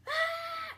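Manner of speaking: a high, loud mock scream, copying the actress; imitated scream. Retelling the show, imitating Mary Louise's scream. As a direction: let out a shrill scream as a retold bit. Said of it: "she just starts screaming".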